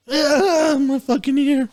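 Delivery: high, loud comic howl; mock pained wail. Bitten man crying over his ear, hammed-up agony.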